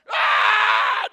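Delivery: loud, long comic scream; hammed-up panic yell; mock terrified scream. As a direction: scream as if fleeing in horror. Healthy man flees the pregnant lady.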